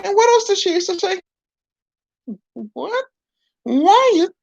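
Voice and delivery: a prim dummy chiding; puppet voice. Acted. Puppet scolds, recalls phrase.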